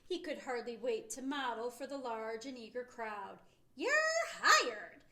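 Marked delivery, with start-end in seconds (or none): 3.7-5.0 loud, high joyful shout. Acted. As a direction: call it out, thrilled. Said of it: "shouted Beezer".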